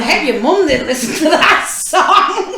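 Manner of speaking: laughing it out in shock; half-laughing disbelief. Real, not acted. Reacting to crude lyrics, laughing in disbelief.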